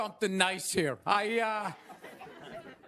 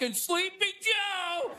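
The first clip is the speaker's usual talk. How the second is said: mock Trump; 7 semitones higher, slower than usual. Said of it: "Trump was just like".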